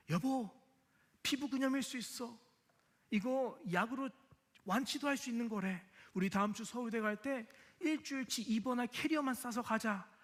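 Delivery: high-pitched, eager rush of news; fussing excitedly at his wife. Retold or imitated. Husband excitedly tells wife the good news, high and eager. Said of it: "호들갑 떨면서 말했죠".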